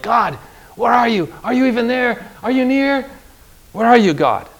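high, searching questions aimed upward; anxious plea to God. Retold or imitated. Preacher voicing a troubled believer asking God where he is, searching. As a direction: ask for God as if lost and alone. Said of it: "when you're like".

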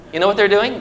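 normal talking voice

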